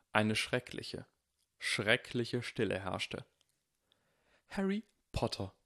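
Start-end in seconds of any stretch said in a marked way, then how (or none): 4.5-5.5 gasped. A narrator's reading. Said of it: "keuchte Professor McGonagall".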